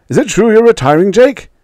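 high, loud, fast question; reporter calling out. A narrator's reading. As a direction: shout it from a press pack. Reporters call questions to Jake.

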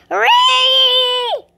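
piercing reflex shriek; high scream. Real, not acted. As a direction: shriek at the jump scare. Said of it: "I keep on screaming".

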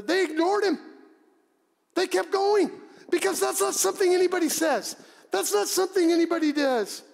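high, forceful outburst; emphatic preaching. Real, not acted. Preacher telling congregation they ignored Jesus, impassioned and high.

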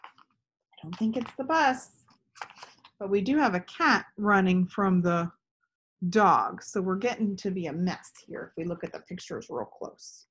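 plain reading voice

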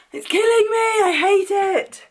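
mock-dramatic frustration; frustrated outburst. Real, not acted. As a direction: vent about the internet. Raging about the broken internet.

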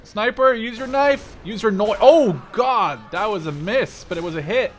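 high, loud frantic orders; excited shouting. Real, not acted. Player frantically yelling at his sniper in excitement. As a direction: yell at the sniper in a frenzy.

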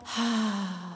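slow, sighing ah; relaxed ah. Shown as a demonstration. Voice coach showing the group a relaxed sighed ah. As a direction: let the ah fall out on a sigh.

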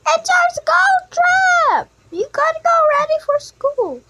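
high, loud, bursting announcement; excited shout. Acted. Kid telling sibling it's the school trip, loud excited shout. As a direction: shout it out, almost bouncing.

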